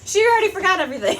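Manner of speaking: high, loud, fast amused remark; amused aside. Real, not acted. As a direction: laugh it off about her. Amused aside about daughter.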